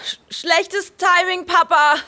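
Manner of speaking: shouted back through the door; calling out. Acted. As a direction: daughter shouting back at her father. Hungover daughter shouts at father.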